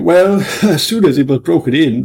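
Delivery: amused officer telling a tale; laughing storyteller. Acted. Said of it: "the superintendent laughed".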